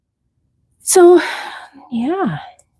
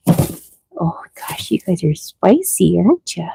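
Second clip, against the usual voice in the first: soft whisper to the rabbits; whispered teasing; 4 dB quieter than usual. Real, not acted. Owner whispering to her spicy rabbits, soft whisper. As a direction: whisper it fondly to feisty bunnies.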